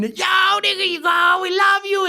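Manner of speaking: high shouted cheer from the yard; shouted farewell. Retold or imitated. Inmates cheering a friend at the gate, high shout. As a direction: call out to a friend leaving.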